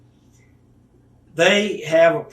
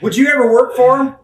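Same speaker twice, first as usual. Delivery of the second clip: quoted question; 8 semitones higher, 8 dB louder than usual. Retold or imitated. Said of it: "They said".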